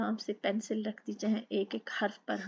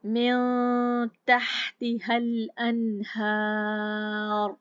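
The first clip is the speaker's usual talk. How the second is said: very slow, louder chanted verse; tajweed recitation; 6 dB louder, much slower than usual. Shown as a demonstration. Teacher recites the next verse for students, slow chant. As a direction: draw the verse out slowly. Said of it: "अहकामात के मुताबिक चलना".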